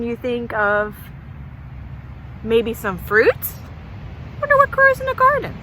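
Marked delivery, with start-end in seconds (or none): 4.4-5.6 put-on high, fast wondering kid voice; curious goat kid. Little goat kid wondering aloud about the garden, high and quick. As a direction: squeak it out like an excited little goat.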